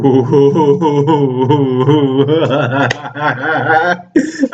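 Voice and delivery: rising burst of helpless laughter; long giddy laugh. Real, not acted. Reacting to the giants, a long helpless laugh. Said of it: "I don't know why I'm laughing".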